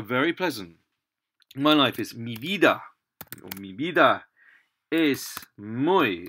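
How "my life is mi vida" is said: ordinary delivery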